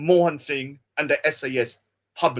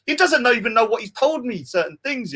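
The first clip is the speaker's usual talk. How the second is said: normal talking voice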